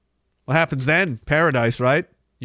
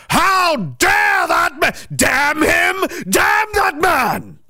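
hammed-up slow outraged shout, higher; mock outrage; 8 semitones higher, much slower than usual. To the viewers about the pianist, a hammed-up mock-outraged shout. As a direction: bellow it like a scandalised old gent.

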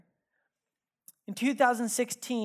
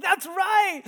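shout; 12 semitones higher, 9 dB louder than usual. Retold or imitated. Retold yelling at his son.

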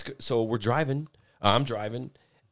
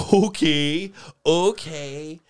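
stunned okay; 6 semitones higher, 7 dB louder, much slower than usual. Retold or imitated. Reacting to patch.

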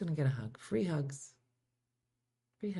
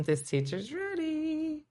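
a hint of a mock teacher voice; 10 semitones higher, 5 dB quieter, slower than usual. Playful aside.

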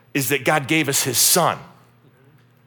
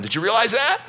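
ordinary delivery

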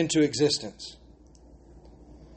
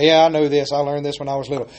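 ordinary talk

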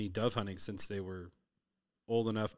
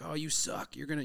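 higher teasing jab at the buddies; mock trash talk; 6 semitones higher than usual. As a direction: rib them like a cocky kid. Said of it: "she's trash talking".

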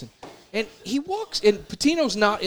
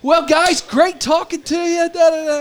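higher, louder, gushing pleasantness; mock sweet talk, pitched up and loud; sudden fake politeness; 9 semitones higher, 6 dB louder than usual. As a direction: switch on the charm for the boss. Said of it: "complete dick to".

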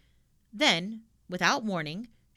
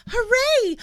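much higher, loud joyful cheer; delighted cheer; 12 dB louder than usual. A narrator's reading. Tommy and Annika cheer Pippi's news, loud and delighted. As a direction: shout for joy. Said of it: "clapped their hands in delight".

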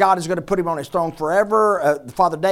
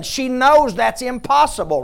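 ordinary talk

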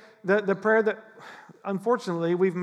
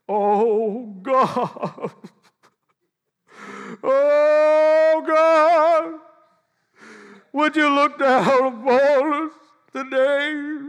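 high, loud and slow, a hammed-up holy tremble; mock religious prayer voice; 9 semitones higher, 8 dB louder, much slower than usual. Preacher mocking how people start praying, hammed-up pious voice. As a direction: lay on the churchy pious tone thick. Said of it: "it would just get weird".

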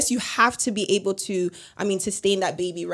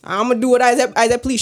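careless mother; 4 semitones higher, 5 dB louder than usual. Retold or imitated. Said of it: "your mother had the choice and be like".

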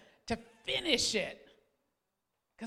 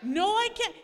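put-on higher and louder, childish back-and-forth; stubborn refusal; 8 semitones higher, 9 dB louder than usual. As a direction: dig in again. Acted marital squabble.

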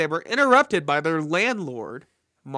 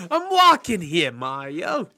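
put-on New York accent; 5 dB louder, slower than usual. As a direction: laugh through a Brooklyn yell. New York quote laughing.

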